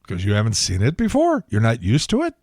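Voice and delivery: ordinary delivery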